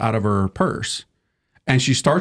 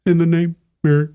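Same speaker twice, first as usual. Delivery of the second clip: muffled bad mic; 4 semitones higher than usual. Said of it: "they need to get the bunny microphone".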